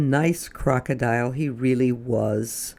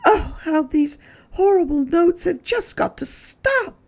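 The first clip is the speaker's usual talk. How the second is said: much higher, desperate lady's cry; desperate Missus Prim. Acted. Missus Prim crying out desperately over the notes. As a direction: plead it out in despair. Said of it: "Missus Prim cried out desperately".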